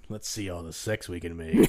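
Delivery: low, laughing joke voice; a hint of a goofy character; mock dumb voice. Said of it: "That's how I talk".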